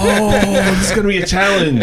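very high, loud, quick squeal; excited squeal. Real, not acted. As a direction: squeal with delight. Excited player, repeated squeal.

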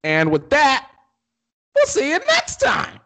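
high, drawn-out singsong farewell; theatrical sign-off. Pretend. Co-host closing the podcast for listeners, theatrical high sign-off.

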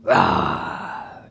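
low mock roar; long low bear roar; Papa Bear growl. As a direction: growl deep as you stomp off.

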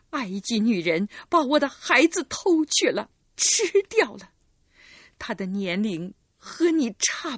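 put-on a wronged mother pouring out old grief; bitter old recluse.

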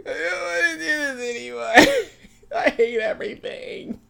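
high mock-crying whine; hammed-up sobbing complaint, breaking into laughter; retold tearful misery. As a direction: wail it like a miserable teen. Retelling misery at first period.

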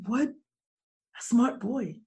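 usual speaking voice

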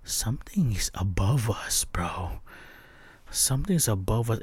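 hushed, frightened warning; scared whisper. Retold or imitated. Driver warning his girlfriend in a scared whisper. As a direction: whisper the danger to the person next to you. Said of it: "and I'm like".

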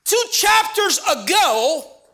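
full-force sermon climax; preaching at full force. Acted. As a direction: drive the point home, slowly.

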